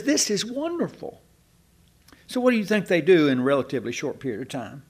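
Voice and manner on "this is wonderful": high, thrilled "This is wonderful"; delighted praise. Retold or imitated. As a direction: gush over it like the nuns did. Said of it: "everybody says".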